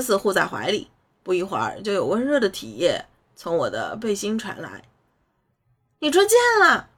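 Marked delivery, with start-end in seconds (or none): 6.0-6.9 high, loud shocked cry. A narrator's reading. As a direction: shriek in dismay. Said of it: "我大呼一声".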